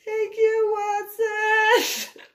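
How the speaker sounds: high, loud mock gratitude; sarcastic thanks. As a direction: thank him with biting sarcasm. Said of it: "And I'm like".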